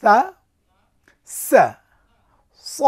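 সা light, সা with a hiss, স heavy and full; letter drill. Shown as a demonstration. Teacher drills Arabic letters for students, loud and clear. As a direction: say সা, সা, স as three distinct letters. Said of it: "আপনার স্ক্রিনে দেখে পড়ুন".

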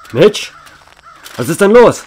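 loud, rising, worried call; alarmed call. Acted. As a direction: demand an answer, getting scared. (besorgter, lauter Ruf)

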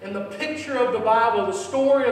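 high, loud, stretched-out preaching; full-force preaching. Acted.

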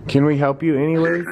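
ordinary talk

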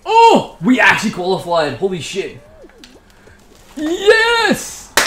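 high gasp then a cheer; excited disbelief. Real, not acted. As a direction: shout it out in disbelief. Qualifies for next round.

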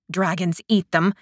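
ordinary delivery

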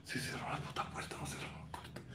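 frightened whisper. Real, not acted. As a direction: whisper so nobody hears. Whispering after door shut.

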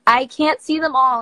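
ordinary talk

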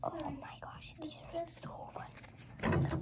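soft, slow delighted whisper; hushed private exclamation; whispering to self. Real, not acted. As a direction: whisper it to yourself, drawing it out. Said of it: "I was just whispering to myself".

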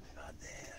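hushed. Pretend. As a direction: coax them quietly to look. Tour guide hushes in catacombs.

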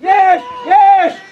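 very loud repeated command; shouted order. Real, not acted. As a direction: yell each word hard across the pitch. Coach calling orders to players. (ordin strigat cu putere)

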